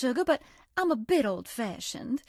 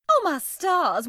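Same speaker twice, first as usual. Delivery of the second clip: surprised exclamation; 5 semitones higher, 7 dB louder, slower than usual. Acted. Exclaiming at a mess.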